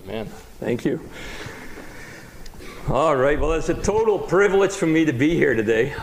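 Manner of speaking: ordinary talk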